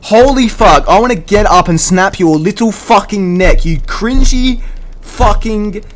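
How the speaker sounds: high, loud, furious rant with drawn-out swearing; infuriated outburst. Retold or imitated. Retelling the night's most infuriating moment, infuriated shouting. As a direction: blow your top, stretching the curses at full shout. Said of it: "that was the most infuriating".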